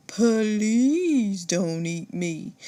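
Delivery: slow, drawn-out mock fear. A narrator's reading. Monsieur Otter mocking the old gator, slow sarcastic fear. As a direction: pretend to be frightened, sarcastically. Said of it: "he mocks".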